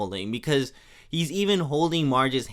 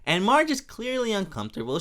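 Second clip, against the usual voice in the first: ordinary talk, nothing marked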